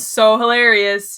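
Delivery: sarcastic. Real, not acted. Sarcastic reply, slow.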